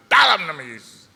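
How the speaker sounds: high, loud commanding deliverance prayer. Retold or imitated. Pastor praying loudly over the haunted house, commanding. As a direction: drive the spirits out at full voice. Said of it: "Tiga kali".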